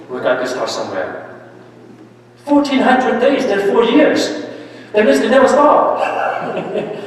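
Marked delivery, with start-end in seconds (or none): 2.4-4.4 higher and louder, stretching the number out in disbelief; as if the figure is hard to believe; amazed. Real, not acted. Repeating the athlete's number to the class, amazed. 4.9-7.0 laughing. Real, not acted. Laughs at endless training.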